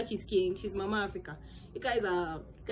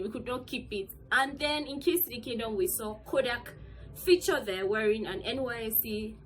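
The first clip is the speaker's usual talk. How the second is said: ordinary delivery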